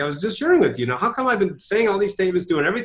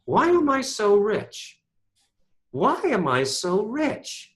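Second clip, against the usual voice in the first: slow, searching "Why am I so rich?"; the coach demonstrating the new question slowly; slow wondering question; 4 semitones higher, much slower than usual. Shown as a demonstration. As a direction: ask it as if truly wondering. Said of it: "what would the question be".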